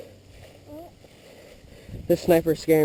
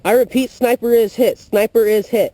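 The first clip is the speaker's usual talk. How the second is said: loud, urgent, higher report; excited radio call; 5 semitones higher, 5 dB louder than usual. Real, not acted. Radio report after hitting sniper.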